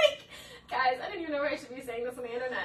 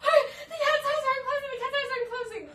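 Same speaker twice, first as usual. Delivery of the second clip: panicked mom; 12 semitones higher, 5 dB louder than usual. Retold or imitated. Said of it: "she's like".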